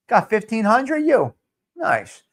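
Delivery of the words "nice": normal talking voice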